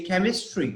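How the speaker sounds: chemistry with a hard k sound. Shown as a demonstration. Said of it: "I am pronouncing it as ka".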